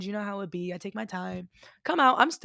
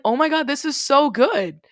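higher and louder, thrilled; delighted gush; 6 semitones higher, 7 dB louder than usual. Retold or imitated. To the barista, tasting the latte, delighted. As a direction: gush over the drink.

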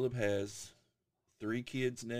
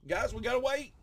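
high mock reply; 4 dB louder than usual. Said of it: "And I'm like".

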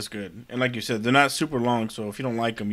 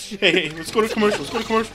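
high, raised mock-urgent voice; frantic calling out; 9 semitones higher, 6 dB louder than usual. Co-host begs to cut away mid-attack, play-acted frantic shouting. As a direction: call desperately for a break.

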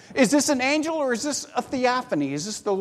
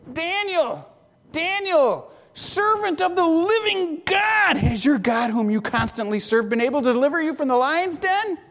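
higher, anxious calling out Daniel's name; troubled cry; 6 semitones higher than usual. Acted. King calling into the lions' den, troubled and anxious. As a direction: call down into the den, afraid of the answer. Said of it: "he cried out with a troubled voice".

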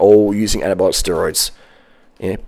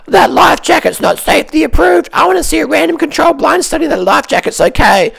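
much higher, shouted mock voice of a nitpicker; mocking fussy critic. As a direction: ham up a whiny safety pedant. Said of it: "the fuckwit in the jetty saying".

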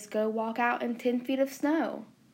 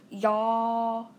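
lightly put-on Southern drawl; mock Southern accent. Podcaster mocking what Northerners think Southerners say, mock Southern accent. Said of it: "us Southern people have an accent".

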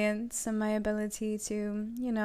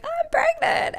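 mock gushing; 5 dB louder than usual. Hypothetical gushing pregnancy announcement.

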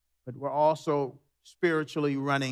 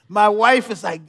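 usual speaking voice

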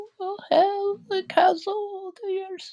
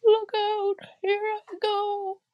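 wailing, despairing witch. Acted. The dying witch bids Dorothy farewell while melting, wailing. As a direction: draw out each phrase in a falsetto wail. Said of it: "in a wailing, despairing voice".

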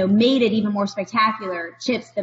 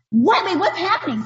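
shocked disbelief; 7 semitones higher than usual. Retold or imitated. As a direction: react in total disbelief. Shocked at 25 grand cost.

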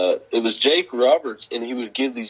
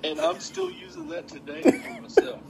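laughing; 4 dB quieter than usual. Real, not acted. Laughs at own remark.

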